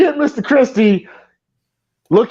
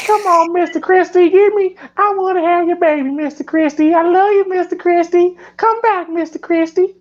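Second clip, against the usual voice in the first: much higher, hammed-up lovestruck waitress; swooning waitress voice; 8 semitones higher than usual. Mocking the diner waitress drooling over Mister Christie, high hammed-up swoon. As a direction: gush over him like a horny diner waitress, mock breathless. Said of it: "I don't know why I'm talking like that".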